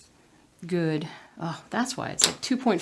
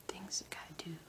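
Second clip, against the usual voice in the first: soft whispered mutter to herself; whispered to self; 3 semitones higher, 13 dB quieter than usual. Real, not acted. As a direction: whisper it under your breath like a to-do list. Muttering to herself while stitching.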